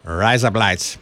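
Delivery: mildly faked Australian accent; attempted Australian accent. Shown as a demonstration. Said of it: "how to do an Australian accent".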